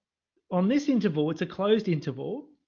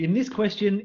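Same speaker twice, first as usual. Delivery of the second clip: normal talking voice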